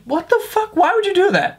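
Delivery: a sudden high yelp of disbelief; shocked disbelief. Real, not acted. To viewers about prank videos, high shocked disbelief. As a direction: squeak it out, baffled.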